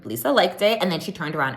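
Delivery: normal talking voice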